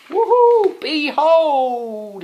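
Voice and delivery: very high, loud triumphant shout; hammed-up victory cheer; triumphant knight. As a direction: burst in like a hero. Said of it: "he says".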